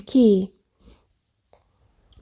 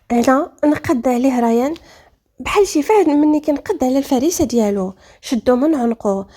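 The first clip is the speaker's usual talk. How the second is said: usual speaking voice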